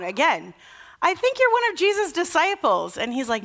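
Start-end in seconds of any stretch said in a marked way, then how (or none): 1.0-3.0 put-on higher, pointed servant girl voice; accusing servant girl. Servant girl accusing Peter at the fire, higher and pointed. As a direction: press him like you're sure you caught him. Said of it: "She's like".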